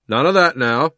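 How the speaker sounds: loud, stern rebuke. A narrator's reading. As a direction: scold firmly. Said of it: "said the policeman sternly".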